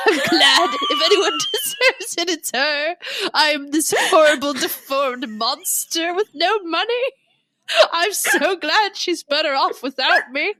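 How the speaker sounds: high, loud mock weeping; melodramatic, blubbering lament; hammed-up self-pity. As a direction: wail it as a wretched martyr. Acting out self-pitying Rochester.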